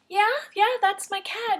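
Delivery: high, eager yes, that's him; overjoyed owner pointing out her cat; excited recognition. Retold or imitated. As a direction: blurt it out, thrilled to see him.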